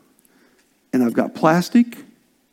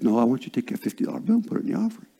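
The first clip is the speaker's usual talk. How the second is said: low, soft, quick divine prompting; quiet inner voice; 4 semitones lower, 8 dB quieter, much faster than usual. Retold or imitated. Spirit prompting preacher during a service, quiet inner voice. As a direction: murmur it low like a nudge from within. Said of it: "He says".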